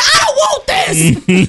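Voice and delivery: very high, slow, loud sobbing wail; crying wail. Retold or imitated. Mom wails at the scribble paper, sobbing. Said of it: "I said".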